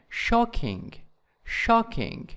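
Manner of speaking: "shocking" stressed on SHOCK; stress on first syllable. Shown as a demonstration. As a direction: hit the first syllable of "shocking", then drop. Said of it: "in the first syllable".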